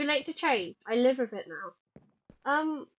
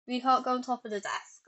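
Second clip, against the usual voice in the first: usual speaking voice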